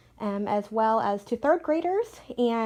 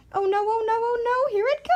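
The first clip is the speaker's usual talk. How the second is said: much higher, louder whimpering oh no; hammed-up crying panic as something comes; silly panicky wail; 14 semitones higher, 5 dB louder than usual. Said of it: "make silly sounds, like".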